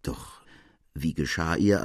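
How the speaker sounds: plain reading voice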